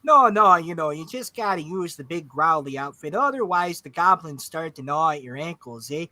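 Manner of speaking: folksy Canadian with eh; friendly backwoods Canadian lilt; Canadian accent. Acted. Orc explaining the growly act, Canadian accent. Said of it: "Moose from Brother Bear".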